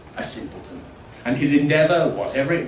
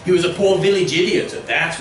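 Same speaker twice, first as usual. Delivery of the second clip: villager voice; 6 semitones higher, 7 dB louder than usual. Acted. Villager scorns the mute.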